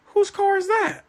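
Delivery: a sharp high-pitched double take; surprised question. Retold or imitated. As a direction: pitch jumps way up in disbelief. Suspicious husband wondering aloud.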